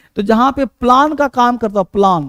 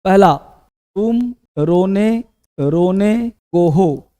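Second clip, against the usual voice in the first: slow dictation; much slower than usual. Shown as a demonstration. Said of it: "पहला example".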